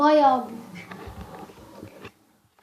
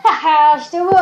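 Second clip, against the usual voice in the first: delighted laughing cheer; excited laugh; 8 dB louder than usual. Real, not acted. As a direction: laugh with joy at the win. Happy at good prize.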